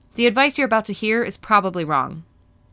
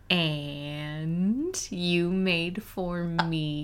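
mock hurt; 2 semitones lower, 7 dB quieter, much slower than usual. Fishing for a gift back.

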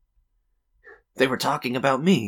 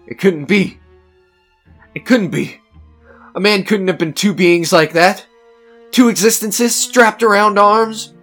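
high, loud, horrified disbelief; mounting panic; 13 semitones higher, 12 dB louder than usual. Acted. Man watching himself in the chair, rising panic. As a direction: build in panic towards a scream.

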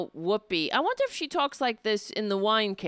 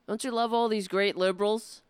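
usual speaking voice

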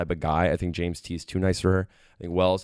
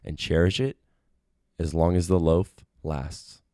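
poem reading; 3 semitones lower, slower than usual. Pretend. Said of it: "That was a little poem I wrote".

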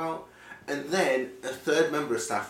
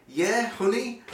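mock insistent; 10 semitones higher, 4 dB louder, slower than usual. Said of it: "And she went".

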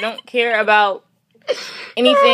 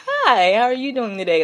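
usual speaking voice

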